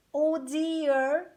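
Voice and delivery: ordinary delivery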